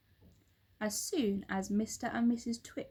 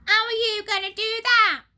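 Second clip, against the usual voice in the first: much higher, loud shrill nag; shrill old wife; 13 semitones higher, 13 dB louder than usual. A narrator's reading. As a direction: screech it like a cranky old wife. Missus Twit's shrill question.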